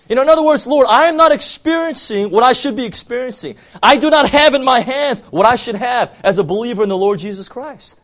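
high, loud, pleading cry to God; fervent loud complaint prayer; preached lament. Acted. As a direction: shout your frustration up at heaven. Gideon's complaint, preached at full force.

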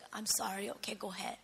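very soft, low, near whisper; crying mother, softly. Retold or imitated. Tormented mother letting her daughter go to sleep, hushed and tearful. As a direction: give in quietly, barely voiced. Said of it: "And she was crying".

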